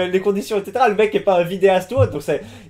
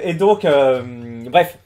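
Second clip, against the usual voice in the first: ordinary delivery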